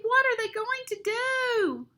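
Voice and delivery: high anxious wail; dismayed high-pitched question; worried cry. A narrator's reading. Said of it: "cried Penelope".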